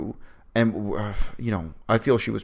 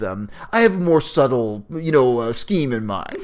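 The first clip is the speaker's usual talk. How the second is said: self-aware Daenerys; 2 semitones higher, 4 dB louder than usual. Retold or imitated. Showing how an actress could read the line, arch and knowing. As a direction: play it knowingly provocative. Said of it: "another actress could have interpreted that".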